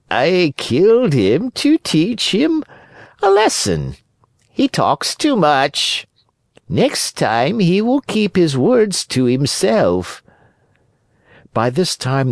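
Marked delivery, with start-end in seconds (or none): 0.1-10.2 high, slow cat voice; high, drawn-out smug cat; unrepentant cat. Acted. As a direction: justify yourself in a high, haughty drawl. Cat answers Pinocchio.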